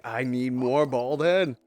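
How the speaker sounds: slow, odd put-on voice for the chatter; lightly voiced goofy chatter; imitating a chatter. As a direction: drag it out like a goofy fan. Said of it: "He's like".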